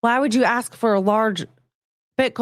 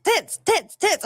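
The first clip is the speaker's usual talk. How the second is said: high, punchy repeated chant; mock crowd yell; 11 semitones higher, much slower than usual. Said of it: "sound like multiple people are yelling it".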